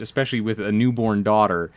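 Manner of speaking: usual speaking voice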